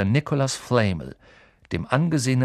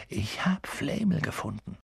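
hushed excitement; 5 semitones higher, 6 dB quieter than usual. A narrator's reading. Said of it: "flüsterte er".

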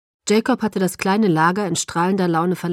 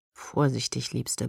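low, soft murmur; 6 semitones lower, 8 dB quieter, slower than usual. A narrator's reading. Edward warns Bella mid-kiss, murmuring low. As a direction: murmur it against her lips. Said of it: "murmelte er".

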